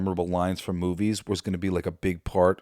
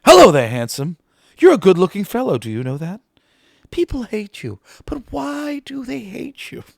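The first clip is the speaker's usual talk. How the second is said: much higher, loud, wheedling sing-song; a trapped doctor sweet-talking the monster, much higher and pleading; flattering the monster; 13 semitones higher, 7 dB louder than usual. Retold or imitated. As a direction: coax a monster out of killing you. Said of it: "he's like".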